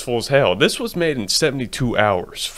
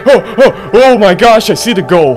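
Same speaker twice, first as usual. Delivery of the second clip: excited; 7 semitones higher, 8 dB louder than usual. Real, not acted. Sees the goal, gets excited.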